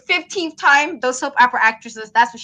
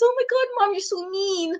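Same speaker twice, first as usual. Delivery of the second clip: high, whiny mock-crying child; toddler fake crying; 8 semitones higher, 4 dB quieter than usual. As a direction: whine at mom with put-on tears. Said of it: "The fake crying you do when you want your mom".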